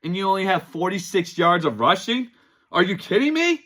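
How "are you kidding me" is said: pitched way up in disbelief, can't believe it; are you kidding me, cry it out high and incredulous; fan's voice jumping up high in exasperation at his team; exasperated disbelief. Real, not acted. Fan ranting about his team's loss.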